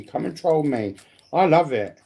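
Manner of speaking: ordinary talk, nothing marked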